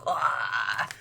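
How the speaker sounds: low, soft exhaled "ah"; spooky breathed ah. Acted. Werewolf breathing on the back of your neck, spooky exhale. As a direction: breathe out like a creeping monster.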